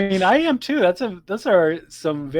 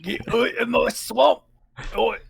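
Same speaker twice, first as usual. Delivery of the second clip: higher hammed-up ogre echo; Shrek impression; 9 semitones higher than usual. Cohost echoing the Shrek line, high and hammed.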